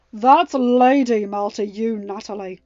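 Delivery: loud, slow and cold; stern rebuke. A narrator's reading. Ben cutting in on Natalie, stern loud rebuke. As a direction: put her firmly in her place.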